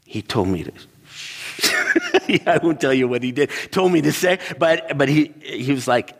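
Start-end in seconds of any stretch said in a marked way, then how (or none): 1.2-2.2 short high burst of laughter; amused giggle breaking out; real laugh. Real, not acted. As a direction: crack up mid-story. Laughs at own story.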